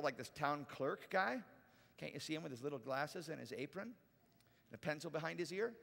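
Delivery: usual speaking voice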